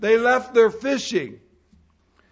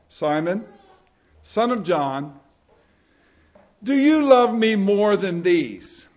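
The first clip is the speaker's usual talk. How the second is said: scripture reading; 4 semitones lower than usual. Acted. Pastor reading John to young people, measured reading. Reads the Gospel passage aloud.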